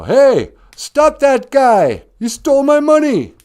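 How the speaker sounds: high, loud shout after a thief; the promoter shrieking after the robber who stole his money; yelling for help. Acted. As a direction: scream at the crowd to stop him.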